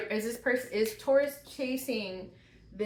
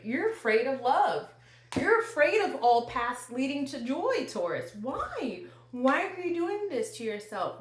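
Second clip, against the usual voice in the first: higher, exasperated pleading; frustrated mom; 4 semitones higher, 4 dB louder than usual. Real, not acted. Tarot reader confronting Taurus about fear of success, frustrated mom. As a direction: plead like an exasperated mother who wants answers. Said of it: "I'm like a mom getting frustrated".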